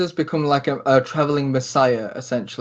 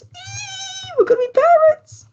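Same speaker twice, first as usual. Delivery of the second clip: shrill, very high squeal of delight; squealing joy. Acted. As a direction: squeal it out like a giddy girl.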